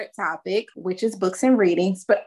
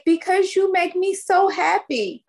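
put-on sweet child's voice; 11 semitones higher than usual. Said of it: "he was like".

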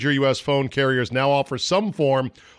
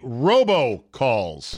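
drawn-out title; much slower than usual. Acted. Segment title read out.

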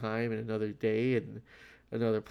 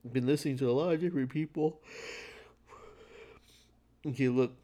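yawning; 3 semitones higher, faster than usual. Real, not acted. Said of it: "Sorry for the yawning".

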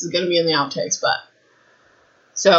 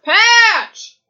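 high-pitched, full-volume real shout; screaming; 7 dB louder than usual. Real, not acted. Owner shouts for her noisy dog, screaming. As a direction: yell the dog's name at the top of your voice. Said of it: "I'm screaming into the mic".

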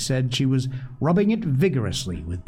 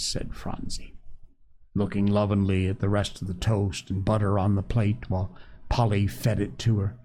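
plain reading voice

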